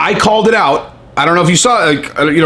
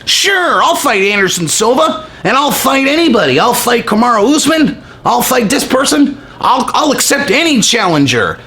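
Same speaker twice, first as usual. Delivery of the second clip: higher mocking tough-guy voice; fake Conor bravado; 6 semitones higher than usual. Vlogger mocking fake Conor's tweets, high mock bravado. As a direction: puff it up like a poser. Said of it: "That's what his fucking Twitter sounds like".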